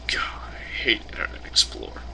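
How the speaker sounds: voiceless whisper, fed up; whispered complaint. Real, not acted. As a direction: whisper your grumble about the browser. Annoyed aside about browser.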